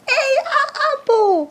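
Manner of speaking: put-on high, excited little-kid yell; child screaming. Girl screaming in mom's class, claiming her. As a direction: shout it like a clingy child in class. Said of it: "I was screaming".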